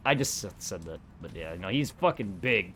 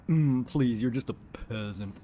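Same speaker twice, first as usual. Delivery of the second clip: snooty rich guy. Retold or imitated. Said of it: "talking like the stereotypical rich guy".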